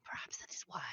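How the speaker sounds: soft conspiratorial whisper. Acted. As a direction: whisper the realization. Character whispers conclusion.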